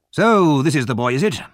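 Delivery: loud, high-pitched opening, lightly voiced; fierce old gentleman. A narrator's reading. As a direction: size someone up as a gruff old gentleman. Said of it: "a fierce old gentleman".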